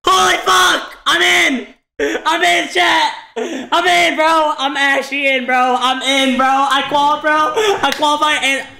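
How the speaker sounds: high-pitched disbelieving joy; ecstatic yelling. Real, not acted. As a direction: shout it in sheer disbelief. Just qualified for grands.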